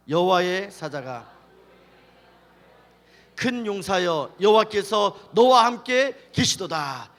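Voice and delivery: loud, slow scripture reading. Real, not acted. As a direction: read the verse out slowly for everyone to follow. (크고 느리게 또박또박 성경 봉독)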